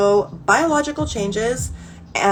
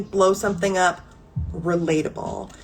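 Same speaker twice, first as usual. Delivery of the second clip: ordinary talk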